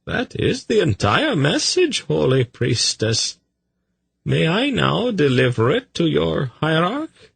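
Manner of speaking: very high, courteous voice; deferential messenger. A narrator's reading. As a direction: patiently placate the suspicious priestess.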